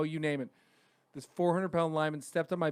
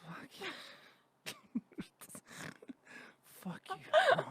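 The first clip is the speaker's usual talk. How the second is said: breathy under-the-breath fuck you; whispered insult; 8 dB quieter, slower than usual. Retold or imitated. Student cursing teacher under breath, whispered. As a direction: mutter it so the teacher can't hear. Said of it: "I looked at him, I go".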